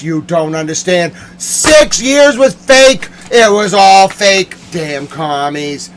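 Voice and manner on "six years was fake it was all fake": high, loud, stretched-out outrage; yelling. Real, not acted. Ranting that years were faked, yelling. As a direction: yell with the first words drawn out. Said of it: "If I start yelling".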